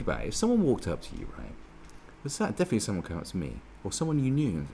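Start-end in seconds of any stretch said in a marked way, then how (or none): none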